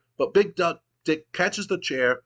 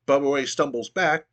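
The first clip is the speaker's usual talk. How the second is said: ordinary talk, nothing marked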